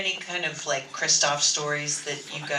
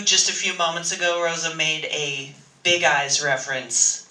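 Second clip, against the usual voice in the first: ordinary talk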